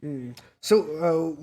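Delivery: ordinary talk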